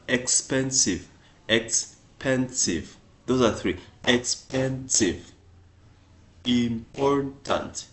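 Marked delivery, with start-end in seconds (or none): 1.5-3.0 "expensive" stretched slowly, ex-pen-sive; slowed for syllables. Shown as a demonstration. Teacher to English learners, slow syllable demo. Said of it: "You hear the, the sound we make". 4.0-7.9 "Expensive" and "important" drawn out slowly syllable by syllable; slow syllable demo. Shown as a demonstration. Teacher showing learners long adjectives, slowly. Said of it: "You hear the, the sound we make".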